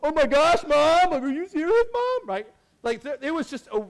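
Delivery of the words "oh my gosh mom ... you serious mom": much higher and louder mock outrage; the sons cringing loudly at their mother; embarrassed son. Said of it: "it's just like".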